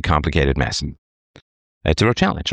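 ordinary talk, nothing marked

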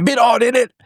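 high-pitched British imitation; mock British accent. Host mimicking an unintelligible British caller, mock British accent.

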